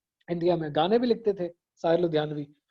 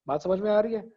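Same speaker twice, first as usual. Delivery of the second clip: usual speaking voice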